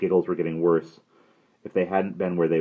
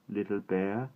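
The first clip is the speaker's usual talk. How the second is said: plain reading voice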